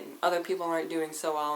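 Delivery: ordinary delivery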